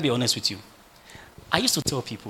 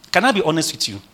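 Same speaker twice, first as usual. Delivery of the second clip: ordinary talk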